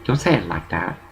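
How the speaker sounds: low, quiet warning not to say it; hushed scolding. Retold or imitated. Telling Orville quietly not to say that. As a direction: hush him urgently. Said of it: "So I told Orville".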